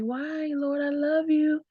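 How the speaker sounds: very soft, high, pleading voice; tender plea to God. Acted. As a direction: plead softly and high, like a small prayer. Acts softer prayer after the outcry.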